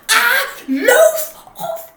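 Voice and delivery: slow, very loud, high shout; top of his voice. Acted. Mister Noisy shouting at the very top of his voice. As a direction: bellow it with all his might. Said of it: "shouting at the very top of his voice".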